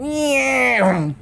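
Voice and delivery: high cartoon cat meow; imitated meow. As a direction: mew like a startled cat. Cat sliding on table.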